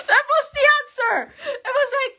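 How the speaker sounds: words squeezed out high through laughter; fast, high, laughing speech. Real, not acted. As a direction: still laughing in disbelief. Laughing at team's answer.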